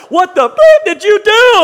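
very high, loud outraged shout; furious yell. Retold or imitated. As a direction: scream it at the kid. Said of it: "I'm like".

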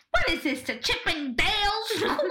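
high, laughing retold outburst; hammed-up disbelief; mock incredulity. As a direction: act scandalized, pitch climbing into laughter. Retold incredulous outburst at a man's entrance.

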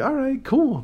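high, pleased little cheer; bright satisfied exclamation; high chuffed voice. Retold or imitated. Pleased after the date.

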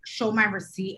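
normal talking voice

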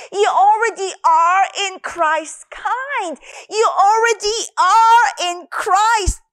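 slow, earnest proclaiming; emphatic sermon cadence; fervent preaching. Acted. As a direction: declare it over them slowly. Preaches identity in Christ.

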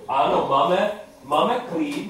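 usual speaking voice

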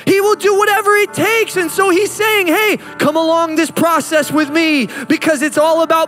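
full-force preaching. Acted. Pastor driving home God's message, climactic preaching. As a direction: lift it up and pour it out over the room.

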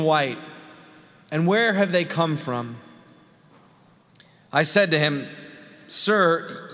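solemn, measured public Bible reading; scripture reading. Acted. Pastor reading Revelation to the congregation, solemn scripture reading. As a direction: proclaim the passage with deliberate pauses between phrases.

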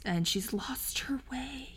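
hushed, slow, breathy-free whisper; dramatic whisper. Pretend. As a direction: whisper it like a sad secret. Dramatic whisper about Wanda.

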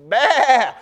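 put-on kid's startling yell from the back pew; loud shout. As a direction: bellow "Bah!" across the church. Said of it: "let out as, like, loud as I could".

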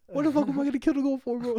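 high, fast, exasperated protest; indignant outburst. Retold or imitated. Telling dad he didn't kill the goat, high and fast in disbelief. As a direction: fire it off, outraged at the accusation.

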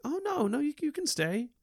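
very high imitated child voice; little boy. Young patient telling visitors they can stay, imitated little-boy voice. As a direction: do a small Southern boy, pitched far up. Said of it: "little boy, Southern accent".

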